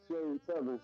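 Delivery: usual speaking voice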